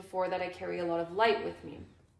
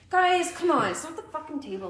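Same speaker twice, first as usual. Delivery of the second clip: mock exasperated boyfriend; 6 semitones higher than usual. Boyfriend brushing off friends about the table, exasperated. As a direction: wave them off, fed up.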